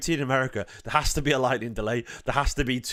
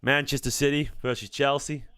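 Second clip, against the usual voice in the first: put-on Southern match host accent. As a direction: announce the fixture in a mock Southern accent. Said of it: "The Southern one was good, apparently".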